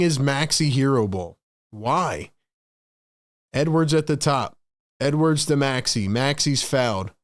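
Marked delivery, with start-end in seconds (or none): none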